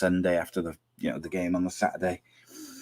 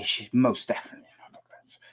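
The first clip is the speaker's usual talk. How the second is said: whispering; 3 semitones higher than usual. Real, not acted. Aside about listener.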